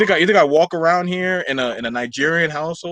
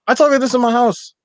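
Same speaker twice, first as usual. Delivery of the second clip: raised, higher-pitched mock comeback; acted defiant retort; 8 semitones higher, 4 dB louder than usual. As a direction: throw it back as an indignant retort. Acts out his own retort.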